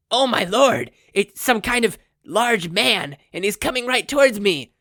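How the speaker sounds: very high, loud panicked shriek. A narrator's reading. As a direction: scream as doom falls from the sky. Cult leader panics at falling man.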